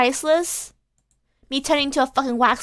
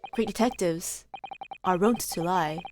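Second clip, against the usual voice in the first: lower, soft knowing detective line; Holmes read; 5 semitones lower, 5 dB quieter than usual. Acted. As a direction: say it like a sly maxim. Holmes line then whispered repeat.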